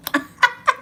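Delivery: real high-pitched giggle; high laugh. Real, not acted. Laughing at her own joke.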